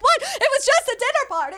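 high-pitched imitation of indignant disbelief; hammed-up high-voiced protest; mock protest. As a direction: protest your innocence in a high mock wail. Acts out protest while being handcuffed.

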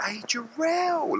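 hammed-up fake friendliness; two-faced greeting. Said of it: "be two-faced and be like".

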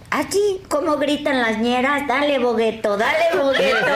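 squeaky high helium voice; high chipmunk voice, laughing. Shown as a demonstration. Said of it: "la digo con Helio".

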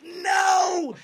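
high, loud, shouted no; desperate no. Retold or imitated. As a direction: yell no in panic. Desperate refusal.

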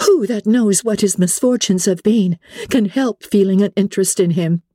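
high, reproachful retort; indignant Elizabeth, defending another. Acted. Elizabeth defends Wickham to Darcy, indignant.

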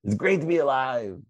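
high, loud rally cry reenacted; retold team rallying yell. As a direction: yell it like a team captain firing up exhausted players. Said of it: "And I'd scream".

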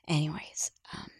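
sigh, then whisper it to move on; a shaky whispered recovery; whispered after a sigh. Real, not acted. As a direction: breathe out and whisper it, composing yourself. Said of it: "I don't wanna cry".

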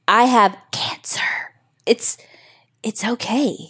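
ashamed hushed whisper. Retold or imitated. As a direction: whisper it like a shameful secret. Mimics people whispering about cancer.

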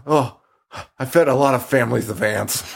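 earnest lisping delivery. Acted. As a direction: say it with a thick lisp. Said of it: "Wait, did he have a lisp?".

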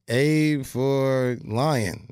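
drawn-out deadpan A for lion; slow deadpan spelling. Retold or imitated. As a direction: spell it out slowly, straight-faced. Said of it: "And I was like".

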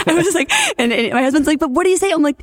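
high, giddy and breathless with a laugh; giddy starstruck. Retold or imitated. To her husband on the phone, giddy and laughing about Brad Pitt. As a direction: gush like a starstruck fan.

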